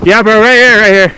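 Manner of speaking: calling out. Real, not acted. Calling friend over on slope.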